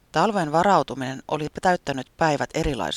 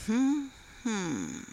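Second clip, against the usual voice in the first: soft higher hum of sympathy; sympathetic humming; 9 semitones higher, 5 dB quieter than usual. Acted. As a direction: hum along kindly, a little softer. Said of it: "Repo Rankka hymisi myötätuntoisesti".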